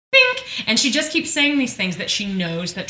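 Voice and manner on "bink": a sharp high ding, like a bell; ping it out high; high bink sound effect. Retold or imitated. Podcast host mimicking a bell after each jab, high bink.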